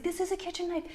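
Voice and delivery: high-pitched exasperated protest; retold freak-out. Wife protests to husband about the knife, retold exasperation. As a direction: act out losing patience, pitch shooting up. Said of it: "And I freaked out".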